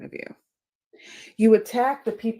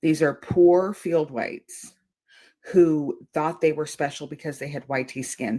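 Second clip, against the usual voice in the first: normal talking voice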